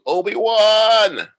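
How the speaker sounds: long drawn-out anguished yell; shouting. Retold or imitated. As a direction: bellow the name, slow and high. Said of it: "He starts shouting".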